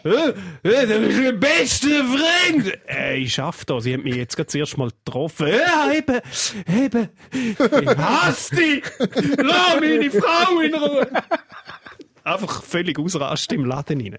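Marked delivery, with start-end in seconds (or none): 0.0-2.7 put-on slurred, slow, loud drunk; town drunk. Acting drunk customer in shop. 5.5-11.1 put-on loud, high, slow drunk raving; town drunk. Acting drunk shopper going wild.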